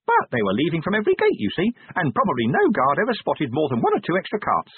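high-pitched little imp delivering a report; high, busy imp voice; officious imp. Acted. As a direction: recite the report as a small, officious creature. Imp's voice.

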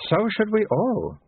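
high, slow abbot; drawn-out, high-pitched holy man; abbot's voice. A narrator's reading. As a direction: say it slowly in a high voice.

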